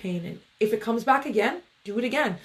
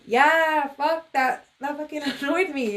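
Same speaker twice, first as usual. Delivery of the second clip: higher-pitched playful venting; mock annoyed; 6 semitones higher than usual. Speaker voicing her annoyed reply to doubters, playfully exasperated. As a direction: blurt it out, amused at your own annoyance.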